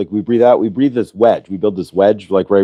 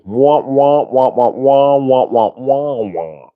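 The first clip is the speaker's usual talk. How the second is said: a light mock wah-wah of a droning grown-up; Charlie Brown adult voice; 3 semitones higher, much slower than usual. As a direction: imitate the muffled cartoon teacher noise. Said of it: "you're just like Charlie Brown".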